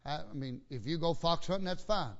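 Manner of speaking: usual speaking voice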